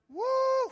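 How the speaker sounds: loud, high "woo"; excited whoop. Real, not acted. Preacher celebrating the story he just told, excited whoop.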